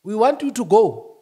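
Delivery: loud and high, shouting bosses; loud, high dismissal. Acted. Voicing bosses who sack him, loud. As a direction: play the bosses firing him.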